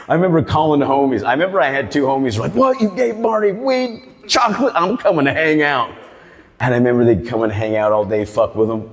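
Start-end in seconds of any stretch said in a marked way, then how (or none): 2.6-5.9 hammed-up high excited friend. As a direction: act thrilled like an eager homie.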